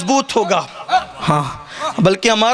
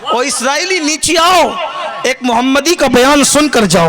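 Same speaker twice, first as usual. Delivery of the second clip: higher, louder ringing call; saint calling out; 4 semitones higher, 4 dB louder than usual. Retold or imitated. Preacher voicing the saint summoning Khizr to listen, loud call. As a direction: call down from the pulpit so he hears. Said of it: "کہتے".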